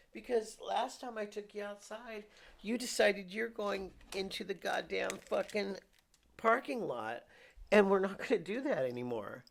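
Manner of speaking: high sing-song pet voice. Real, not acted. Owner scolds the cat about the parking lot in high baby talk.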